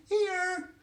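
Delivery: very high, loud held call; insistent call. A narrator's reading. Invisible boy answering his mummy, insistent high call.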